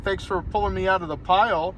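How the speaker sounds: ordinary delivery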